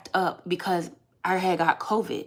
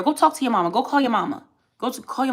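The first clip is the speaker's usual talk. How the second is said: scornful; 4 semitones higher, 4 dB louder, faster than usual. Real, not acted. Dismissing commenter.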